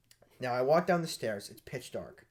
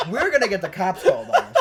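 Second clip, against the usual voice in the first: fast and laughing, pitched up; 7 semitones higher, 8 dB louder, faster than usual. Real, not acted. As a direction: blurt it out through laughter. Laughing panic about cops.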